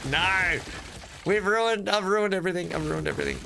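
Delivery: high-pitched wail of dismay; dramatic dismay. Real, not acted. Player to viewers after wrecking the line, dramatic dismay. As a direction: cry out that it's all lost.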